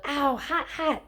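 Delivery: high, laughing yelps of hot pain; hammed-up hot-hot-hot cry. A narrator's reading. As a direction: shake out a burned hand. Grover burned by the whip.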